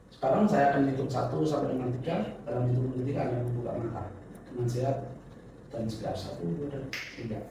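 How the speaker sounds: soft, steady hypnotic count; hypnotist counting. Acted. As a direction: count them gently awake. Wakes subject by counting.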